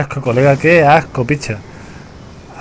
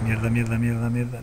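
cursing under breath; 6 semitones lower, 12 dB quieter than usual. Real, not acted. Hiding, hoping not to be seen.

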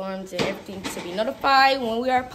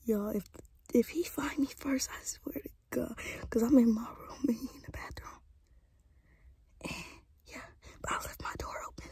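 whispering while hiding; 13 dB quieter than usual. Real, not acted. Hiding in closet during hide and seek.

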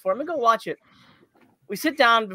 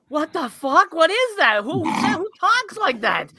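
baffled disbelief; 6 semitones higher, slower than usual. Retold or imitated. Retelling the audience's baffled reaction.

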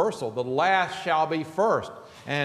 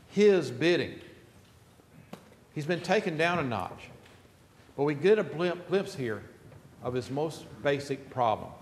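ordinary talk, nothing marked

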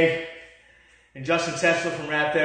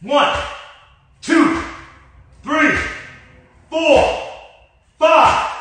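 counting workout reps; 8 semitones higher, 7 dB louder, much slower than usual. Real, not acted. Shouting workout rep count.

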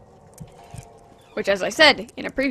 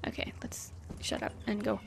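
soft unvoiced whisper; 13 semitones lower, 11 dB quieter than usual. Real, not acted. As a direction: whisper to stay unheard. Sneaking past in horror game.

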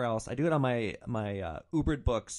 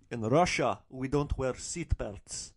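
slow, imitated Russian accent; slower than usual. Russian passenger explains seatbelt habits to driver, in Russian accent.

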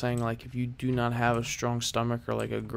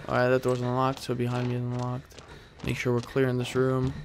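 ordinary delivery

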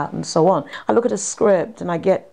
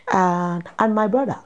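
ordinary delivery

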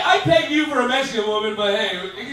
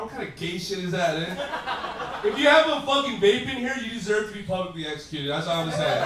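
normal talking voice

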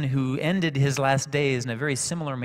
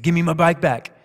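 angry demand; 5 semitones higher, 9 dB louder than usual. Retold or imitated. Angry kid demands his bike.

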